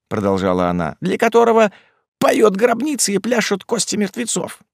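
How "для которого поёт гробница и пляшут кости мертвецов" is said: high-pitched, awed woman's voice; superstitious dread. A narrator's reading. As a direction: warn of the demon in a high, fearful voice. Маас со страхом рассказывает о демоне.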